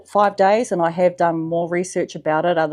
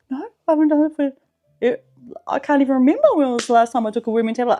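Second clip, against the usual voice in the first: fast, higher voice of mum; imitating mum; 5 semitones higher, 3 dB louder, much faster than usual. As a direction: rattle it off as flustered mum. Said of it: "she's like".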